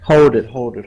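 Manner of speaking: loud urgent hold it; urgent shout. Acted. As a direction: call out to stop her. Stopping the shopkeeper closing box.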